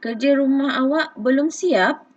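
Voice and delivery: sung-out question intonation; questioning rise. Shown as a demonstration. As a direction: lift the end like a real question. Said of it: "intonasi suara kita pun, okey, ada lenggok dia".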